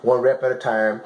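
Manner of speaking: normal talking voice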